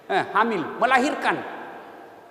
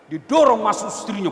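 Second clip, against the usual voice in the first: usual speaking voice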